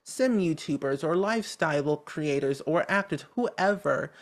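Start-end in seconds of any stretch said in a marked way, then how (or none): none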